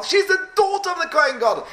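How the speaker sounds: high-pitched, amazed exclamation; astonished realization. Acted. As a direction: cry it out like a stunning discovery. Astonished recognition in the story.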